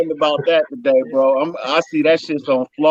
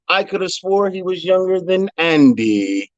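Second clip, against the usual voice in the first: normal talking voice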